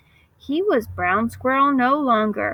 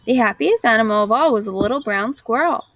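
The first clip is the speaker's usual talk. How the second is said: usual speaking voice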